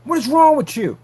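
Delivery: high, loud shrieking wife voice; mock screaming wife. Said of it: "screaming while his wife was like".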